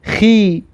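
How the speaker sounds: خی said with a rasped kh and a clear ee; teacher models خی as khee; kasra sound khi. Shown as a demonstration. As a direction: model خی with a scraped kh. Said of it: "کسره که دارد".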